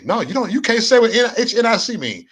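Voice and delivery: high-pitched retold protest; mock incredulous. Troll imitating the man he fooled, mock incredulous. Retold man's flustered challenge.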